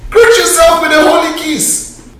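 high, stressed "Greet"; emphatic quote. Acted. Preacher pressing the verse on the church, emphatic. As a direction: ring out the command with a high "Greet". Preacher quoting the repeated command.

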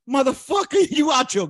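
high, loud laughing yell; mock laughing shout. Mimicking someone laughing at a fake, high laughing shout. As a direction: bellow it through a laugh.

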